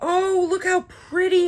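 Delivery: high, gushing admiration; real delight. Real, not acted. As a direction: coo excitedly over something pretty. Gushing over lip gloss colour.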